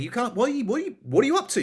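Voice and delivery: high, stumbling scolding, imitated; telling someone off. Boss to baguette-throwing customer, imitated telling-off. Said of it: "had a massive go at him".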